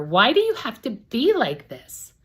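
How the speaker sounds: loud, high, slow angry yell. Acted. Narrator yelling up at her sister in frustration. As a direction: burst out in frustration. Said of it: "I yell up at her".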